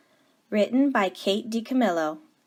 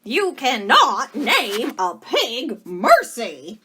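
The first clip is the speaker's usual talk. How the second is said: slow, louder emphatic shout; 5 semitones higher, 8 dB louder, slower than usual. Acted. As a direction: shout down the silly name. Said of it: "shouted Eugenia".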